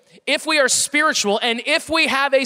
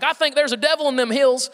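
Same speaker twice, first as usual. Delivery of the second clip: hammed-up Southern US accent, raised in pitch; thick Southern accent; 4 semitones higher than usual. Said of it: "this really thick Southern accent".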